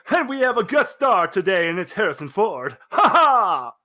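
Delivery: game show host, bright and upbeat; mock TV host. K K announcing to Arizona, game-show host voice. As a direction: announce the guest star like a TV host. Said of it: "K K immediately turns to Arizona and goes".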